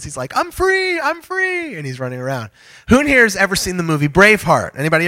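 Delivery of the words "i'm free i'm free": put-on high, giddy boy's cry of joy; gleeful kid. Kid home alone cheering that he's free, high and giddy. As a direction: squeal it like a kid let loose. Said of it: "I love the line that he says".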